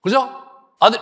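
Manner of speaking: normal talking voice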